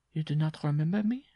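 high character voice, rising; gaunt woman's question. A narrator's reading. Peasant woman to the bareback rider, high questioning. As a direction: ask it haltingly, pitched high.

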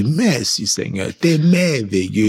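slow, tender thanks to God; grateful prayer. Retold or imitated. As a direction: savour each word of thanks, slowly.

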